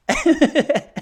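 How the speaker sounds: high burst of laughter; shocked loud laugh; big laugh. Real, not acted. Reacting to Lucy's quote.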